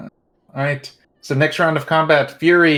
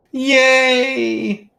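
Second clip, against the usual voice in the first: excited cheer; 10 semitones higher than usual. Real, not acted. Cheer after a hit.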